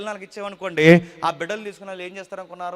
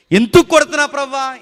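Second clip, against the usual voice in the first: anguished cry; 8 semitones higher, 16 dB louder than usual. Retold or imitated. Believers crying out to God.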